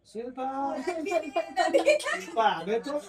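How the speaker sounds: a strained, half-laughing call, pitched high and stretched slow; retold call for help. Husband, hurting, calls his wife from the bathroom, a strained high laughing call. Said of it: "હું તો બાથરૂમમાંથી બૂમ પાડું".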